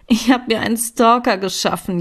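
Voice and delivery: a mild whiny wail over her stalker; half-wailed complaint, lightly played; whining. A narrator's reading. Katinka whines to Lea about her stalker, a mild wail. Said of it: "jaulte ich".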